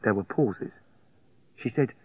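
ordinary delivery

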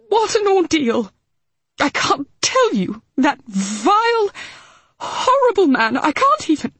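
high, choked-up indignation; fighting back tears over the vile man; tearful outrage. Acted. Mitzi to Hal, tearful and furious. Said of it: "wiping fiercely at her eyes".

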